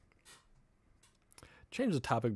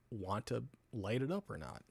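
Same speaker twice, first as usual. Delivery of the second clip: ordinary talk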